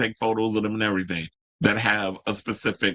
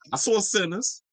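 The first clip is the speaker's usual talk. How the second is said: much higher, pointed jab; sassy exclamation; 9 semitones higher than usual. Real, not acted. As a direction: needle them with a knowing jab.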